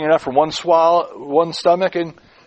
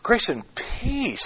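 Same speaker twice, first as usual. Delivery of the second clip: soft, high, drawn-out "peace"; slow phrase with a long, soft "peace"; stress on PEACE; 6 semitones higher, 6 dB quieter, much slower than usual. Shown as a demonstration. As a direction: linger wonderingly on "peace", quieter. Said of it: "How does peace figure?".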